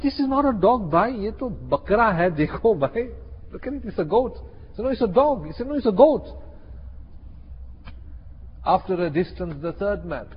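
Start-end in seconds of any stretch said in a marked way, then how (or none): 0.0-4.4 high-pitched, insistent objection; mock indignant insistence; acted protest. Farmer protests it is a goat. 4.8-5.5 high, insistent retort; mock squabbling tone; acted contradiction. Thief contradicts the farmer. 5.7-6.3 high, loud counter-retort; mock indignant squabble; acted insistence. Farmer insists back.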